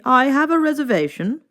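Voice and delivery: high, cheery chicken greeting; Mister Chicken's greeting. A narrator's reading. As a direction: chirp it out brightly as the bird. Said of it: "said Mister Chicken".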